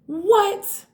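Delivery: high, loud, disbelieving what; startled what. Real, not acted. Reader reacts to cards stuck back to back, startled. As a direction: shoot up in disbelief.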